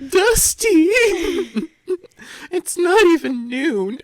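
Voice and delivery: high, slow, laughing through the line; laughing quote. Retold or imitated. Retelling the stage line to castmates, high and giggling. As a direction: squeal it out between laughs. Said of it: "Tori goes".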